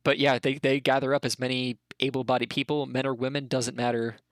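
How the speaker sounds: normal talking voice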